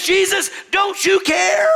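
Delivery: very high, slow indignant cry; scolding. Acted. Martha takes Jesus to task, scolding. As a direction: scold with a drawn-out rising question. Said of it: "Martha scolded her Savior".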